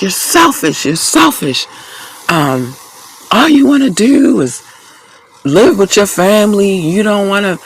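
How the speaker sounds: high-pitched mock rant as the ex; mocking the angry ex. Woman mimicking her angry ex's rant, high mocking voice. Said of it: "now he's losing it. He's mad".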